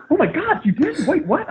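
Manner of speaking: very high, startled wife's voice; wife's disbelief. Retold or imitated. Wife on the plane hearing the news, surprised. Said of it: "She's like".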